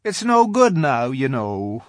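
mildly sorrowful faun, high and loud; a touch of regret in a high voice. A narrator's reading. Faun shaking his head at Lucy, mildly sorrowful. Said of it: "shaking its head at her very sorrowfully".

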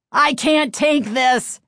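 loud slow pained wail. A narrator's reading. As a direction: wail through onion tears. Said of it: "he wailed".

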